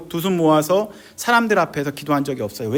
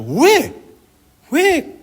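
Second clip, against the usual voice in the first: high, sharp cries of protest; confronting God; 12 semitones higher, 6 dB louder, much slower than usual. Retold or imitated. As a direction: re-enact demanding an answer from God. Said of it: "하나님께 또 따졌죠". (높게 따져 묻는 외침)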